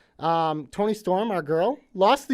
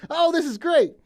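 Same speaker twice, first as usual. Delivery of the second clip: higher-pitched re-enacted delight; acted excited reaction; 10 semitones higher, 4 dB louder than usual. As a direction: replay your thrilled reaction. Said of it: "and I was like".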